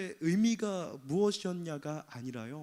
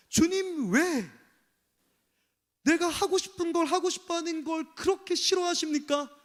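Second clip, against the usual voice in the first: arguing with God; 8 semitones higher, 5 dB louder than usual. Retold or imitated. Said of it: "하나님께 따지는".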